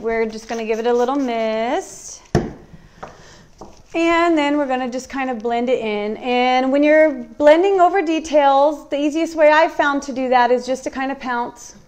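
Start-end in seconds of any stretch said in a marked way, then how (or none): none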